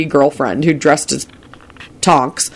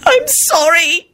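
high, drawn-out tearful apology; wailed; slower than usual. A narrator's reading. Rosie scolded and near tears, wailing. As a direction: wail it like a scolded girl. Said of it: "Rosie wailed".

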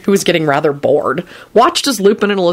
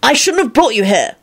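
raised-pitch, anguished regret; 7 semitones higher than usual. A narrator's reading. Lupin regretting bringing Elizabeth, anguished. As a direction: let regret push the voice higher. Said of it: "in an anguished voice".

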